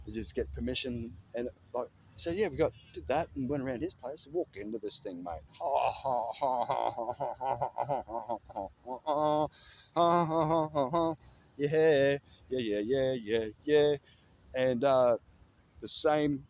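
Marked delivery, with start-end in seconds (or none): 5.6-11.1 a long, rolling real laugh that keeps coming; a long run of real laughing; real hearty laughter. Real, not acted. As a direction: laugh freely at the memory. Laughing at the memory.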